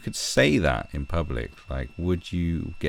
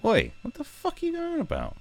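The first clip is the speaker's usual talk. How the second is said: much higher, put-on yelling bloke; angry bloke. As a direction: bark it like a fed-up passer-by. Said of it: "People would tell you to shut the fuck up and go".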